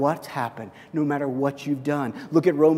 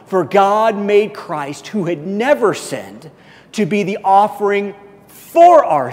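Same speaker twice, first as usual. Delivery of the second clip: raised, ringing pulpit voice, higher and louder; preaching at full force; 6 semitones higher, 5 dB louder than usual. Acted. Pastor preaching the scripture to his congregation, full force. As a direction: proclaim it to the whole room.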